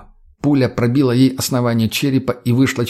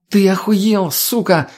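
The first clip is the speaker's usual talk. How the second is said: high-pitched angry outburst; furious; 8 semitones higher, slower than usual. A narrator's reading. Tonya leaps out armed from behind the car, furious. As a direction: burst out in outrage. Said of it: "полной гнева".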